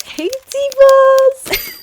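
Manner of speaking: high, loud, stretched call; shouted greeting. Acted. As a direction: belt the greeting out to the viewers. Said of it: "vlog greeting repeated".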